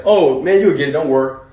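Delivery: loud, cheery fake reassurance; breezy brush-off. [name] brushing off needy mentees, loud fake cheer. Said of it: "I'm like".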